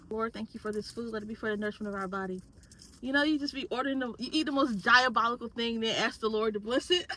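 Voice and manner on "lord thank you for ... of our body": soft, fast mumbled grace; quiet quick prayer. Real, not acted. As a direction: rush through a quiet blessing. Quick grace before drinking.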